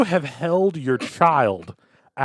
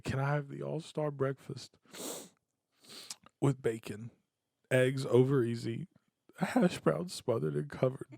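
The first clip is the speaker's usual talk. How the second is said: very soft, hushed order; mock meek ordering; 11 dB quieter than usual. Drunk customer ordering breakfast from waitress, softly meek. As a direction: order in a small, quiet voice.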